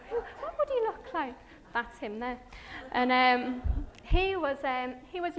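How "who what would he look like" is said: high, puzzled self-quote; wondering aloud. Retold or imitated. Preacher musing to the congregation, high and puzzled. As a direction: ask yourself, pitched up high. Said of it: "I was like".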